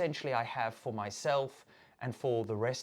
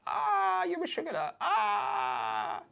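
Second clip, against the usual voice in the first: fond sigh; much slower than usual. Retold or imitated. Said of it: "you're kinda like".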